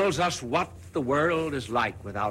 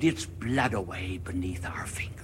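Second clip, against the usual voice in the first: guilty whisper; 2 semitones lower, 5 dB quieter than usual. Acted. Madman confessing the murder to the crowd, whispered.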